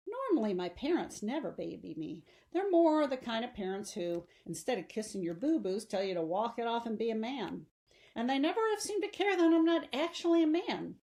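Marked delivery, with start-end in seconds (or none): none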